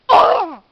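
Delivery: high, very loud exclaimed oh; mock loud oh. Imitating Lucas's reaction, loud mock cry. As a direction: let out a hammed-up cry.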